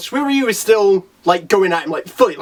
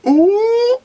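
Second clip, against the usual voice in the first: imitated crowd ooh, pitched way up; high awed ooh; 14 semitones higher, 4 dB louder than usual. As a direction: coo in exaggerated amazement. Acts out awed crowd reaction.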